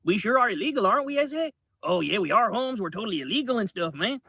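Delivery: clumsy fake Chicano street accent. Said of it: "impression of an impression".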